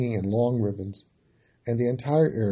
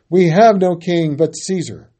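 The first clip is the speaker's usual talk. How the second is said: loud, higher, slow declaration; crowd answering; 5 semitones higher, 9 dB louder than usual. A narrator's reading. Crowd answering Pilate, slow loud declaration. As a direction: spell it out slowly and loudly. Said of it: "They answered".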